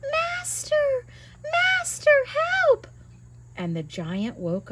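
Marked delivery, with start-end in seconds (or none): 0.1-2.9 very high shrill cry for help; harp crying out. Acted. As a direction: scream for the master like a magic harp. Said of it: "the harp started to cry".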